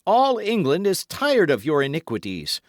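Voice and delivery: high, loud, fervent denunciation; mounting excitement. A narrator's reading. Felton denounces the Duke's iniquities, increasingly excited. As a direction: thunder the warning with rising agitation. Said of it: "becoming more excited as he spoke".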